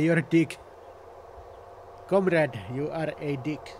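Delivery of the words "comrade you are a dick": slow mock-Russian accent; deliberate, slowed-down fake Russian accent. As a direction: put on a rough comic Russian accent. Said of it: "racist impression there of a Russian accent".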